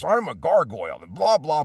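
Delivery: a voice actor demoing his cartoon gargoyle, high and bombastic. Shown as a demonstration. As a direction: boom it out like a big goofy gargoyle. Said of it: "I did a gargoyle on there".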